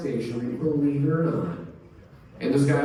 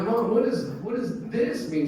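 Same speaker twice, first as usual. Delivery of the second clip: put-on higher, innocent child asking; higher innocent kid asking mom; 8 semitones higher than usual. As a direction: ask like a curious ten year old. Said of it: "I'm like".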